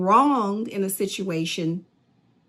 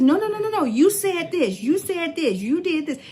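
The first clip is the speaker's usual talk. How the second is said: hammed-up stubborn arguer, pitched up; insistent accuser; 9 semitones higher than usual. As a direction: refuse to hear any explanation. Acted argument, accusing voice.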